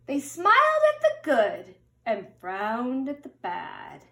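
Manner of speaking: storybook read-aloud, gentle and lilting; children's picture book narration. A narrator's reading. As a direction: read it like bedtime story time.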